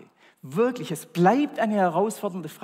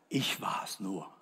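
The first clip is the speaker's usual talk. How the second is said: lower, softer, slow and weary; tired old Eli; 7 semitones lower, 5 dB quieter, slower than usual. Acted. Eli waves off Samuel, weary and slow. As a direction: mumble it like an old man rolling his eyes.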